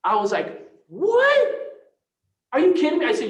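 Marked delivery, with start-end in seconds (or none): 0.9-3.1 much higher and louder, a yelped what; re-living the moment the client asked for help; stunned disbelief. Retold or imitated. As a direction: retell the shock at full tilt. Shocked at the client's offer.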